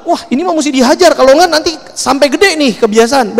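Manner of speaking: high-pitched, mock parental outrage; naik pitam. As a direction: act out a parent losing their temper at a thieving child. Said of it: "Naik pitam tuh". (pura-pura naik pitam, nada tinggi)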